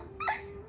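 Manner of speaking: light airy high laugh; a mischievous breathy titter; playful high giggle. Acted.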